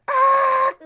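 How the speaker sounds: very high, very loud shriek; piercing child scream; little girl's scream. Acted. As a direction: scream your head off like a small girl. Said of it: "the little girl said".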